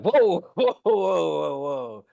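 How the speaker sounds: high startled whoa whoa, backing off; mock alarmed whoa. Mocking a startled reaction.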